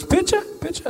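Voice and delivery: high, rising eager tourist voice; high-pitched imitation of an eager Japanese tourist. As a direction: ask it way up high, pleading. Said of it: "She goes".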